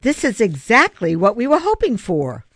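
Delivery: upbeat, emphatic insistence; insistent. A narrator's reading. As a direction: press the good news on her. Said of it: "Griffin insisted".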